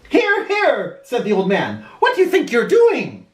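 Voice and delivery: a hint of a startled old gent objecting; protesting old man.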